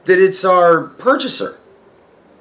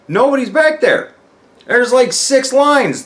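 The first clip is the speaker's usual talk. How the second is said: high-pitched amazed report; astonished; 8 semitones higher than usual. Retold or imitated. Finding the back of the warehouse empty, high astonishment. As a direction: pitch it up in disbelief. Acts out shock at empty lines.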